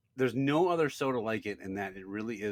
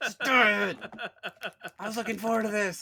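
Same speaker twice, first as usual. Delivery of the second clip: high, loud, half-laughing angry yell; retold angry yell; 12 semitones higher, 8 dB louder than usual. Husband yelling at wife who brought back Diet Dew, loud and high. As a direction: blow up over a letdown, laughing at yourself. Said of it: "I yelled at her".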